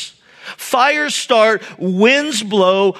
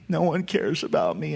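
sulking self-pity; 5 semitones lower, 9 dB quieter than usual. Pretend. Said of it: "sulking in self-pity".

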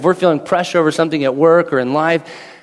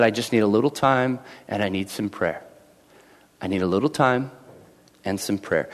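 quiet plea; 7 semitones lower, 8 dB quieter than usual. Retold or imitated. Daniel voiced quietly in sermon.

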